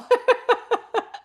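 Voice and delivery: high laugh through tears; embarrassed laugh through real tears; tearful laugh. Real, not acted. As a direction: laugh at yourself while crying. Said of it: "now that I'm crying".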